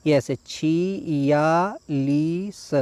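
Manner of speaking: छियालीस drawn out very slowly; teacher modelling छियालीस; slow model word. Shown as a demonstration. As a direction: stretch छियालीस syllable by syllable. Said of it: "पहला वाला देखिए यहाँ पर सही है".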